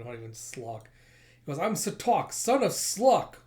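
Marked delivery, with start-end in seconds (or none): 1.6-3.4 high, loud, drawn-out proclamation; boastful declaration. Acted. Villain proclaiming his lineage to his attacker, boastful shout. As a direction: announce yourself grandly.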